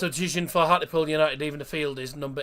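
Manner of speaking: loud stadium-announcer style, names punched out; substitution announcement. Acted. Host tells viewers of York City's double substitution, announcer style. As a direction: announce it to the crowd, name by name.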